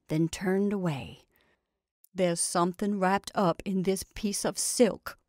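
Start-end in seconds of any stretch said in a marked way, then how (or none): none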